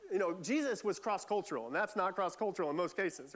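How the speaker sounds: ordinary talk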